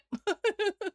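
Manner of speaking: high giggling burst; real helpless laugh. Real, not acted. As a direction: break into a high-pitched laugh, unable to go on. Cracks up reading chat's reaction.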